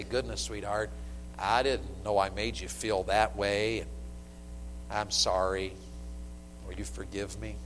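soft, tender sorry to his wife; gentle apology. Retold or imitated. Husband apologizing tenderly to his wife, soft. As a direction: say it softly, making peace. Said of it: "sometimes I have to say".

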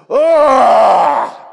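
sudden high, loud mock groan of the Spirit; groaning cry. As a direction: let out one wordless loud groan. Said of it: "Can you imagine the Spirit of God going".

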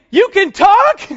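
high, loud startled yelp; loud rising shock; shocked disbelief. Pretend. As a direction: blurt it out as if a donkey just spoke to you. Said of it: "I'm gonna say".

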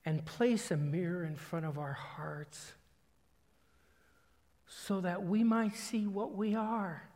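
measured prayer with long pauses between phrases; slow prayer reading. Real, not acted. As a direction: read it slowly to the Lord, leaving space to reflect. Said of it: "I'm gonna read it slower, slowly".

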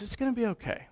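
imitated calm guard, hushed and soothing. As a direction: soothe a panicking parent gently. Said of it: "You're so calm".

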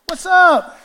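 high, loud cheery call; loud what's up. Pretend. Pastor calling to a friend in the park skit. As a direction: shout a happy hello.

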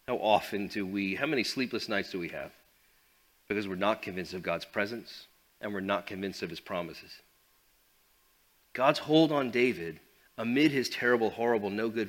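normal talking voice

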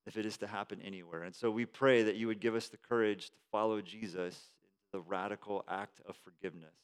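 quiet, earnest pastoral prayer voice. Acted. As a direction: pray it softly over the congregation.

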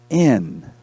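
"in" alone after a pause, falling; stress on IN. Real, not acted. Preacher gives class the answer, stressing "in". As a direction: deliver "in" as the key word. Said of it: "The emphasis is being".

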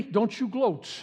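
ordinary delivery

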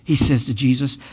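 normal talking voice

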